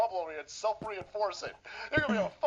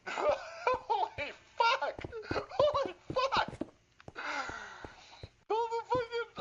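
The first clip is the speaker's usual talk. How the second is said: much higher, slow, laugh-soaked cry; real laughing shock; laughing disbelief; 14 semitones higher, slower than usual. Real, not acted. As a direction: break into incredulous laughter.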